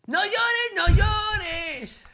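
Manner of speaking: very high, loud wail; shrill-pitched pretend consoling, stretched at the end; mock consoling. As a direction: cry out hammed-up comfort. Mock consoling the crying friend.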